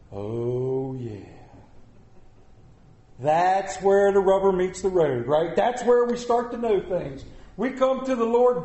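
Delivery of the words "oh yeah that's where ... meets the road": ordinary talk, nothing marked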